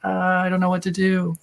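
mock helpless. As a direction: play up being lost and clueless. Quoting own helpless inner voice.

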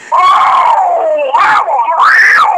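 high, slow, drawn-out mock yell; stunned wrestler. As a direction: stretch out "that" high and hurt, half laughing. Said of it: "repeat of dude's line".

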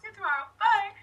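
high, fast, loud peppy farewell; cheerleader goodbye. Retold or imitated. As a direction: chirp it out like a cheerleader. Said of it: "like a cheerleader".

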